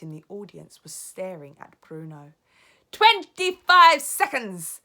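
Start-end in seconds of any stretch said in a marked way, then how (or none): 2.9-4.8 high, loud, slow witch countdown; gloating cry. Acted. Grand High Witch counting down, slow and loud. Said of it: "cried the Grand High Witch".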